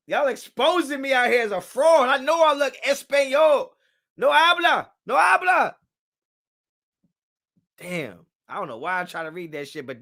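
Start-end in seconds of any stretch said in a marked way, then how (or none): 0.1-5.8 high, loud embarrassed pleading; comic protest. Real, not acted. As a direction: protest your innocence in a raised squeal. Protests being exposed as Spanish fraud.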